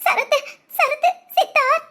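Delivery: sobbing between the words; crying. Acted. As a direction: gasp out the names through tears. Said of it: "ஏன் இப்படி அழுகுற". (விம்மி அழுதபடி)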